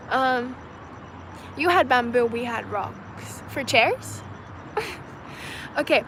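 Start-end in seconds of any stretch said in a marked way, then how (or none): 4.3-5.7 soft, high, breathy giggle; quiet amused laugh. Real, not acted. Reading a viewer's comment, soft breathy laugh. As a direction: giggle under your breath.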